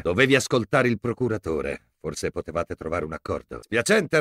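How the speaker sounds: normal talking voice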